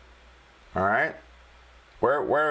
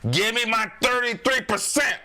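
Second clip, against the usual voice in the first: higher, hammed-up Chicago tough guy; J J, terrible Chicago accent; 10 semitones higher than usual. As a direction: demand it in a put-on Chicago accent. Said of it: "That's just my terrible, uh, uh, Chicago accent".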